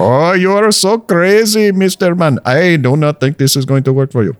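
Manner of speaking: camp it up like a Bollywood hero; Bollywood Indian accent. Pretend. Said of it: "the Bollywood edition".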